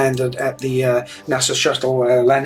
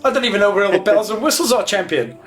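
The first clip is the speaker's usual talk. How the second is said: higher, faster jokey protest; mock outrage; 6 semitones higher than usual. Real, not acted. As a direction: playfully fake being horrified. Teasing protest at a bigger plane.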